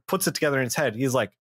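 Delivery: normal talking voice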